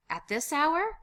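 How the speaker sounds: high, loud, incredulous question; startled question. Acted. Reacting to the buzzer, startled. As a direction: ask it in alarmed surprise. Unexpected visitor arrives.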